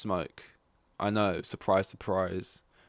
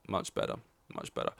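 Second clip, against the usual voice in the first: ordinary talk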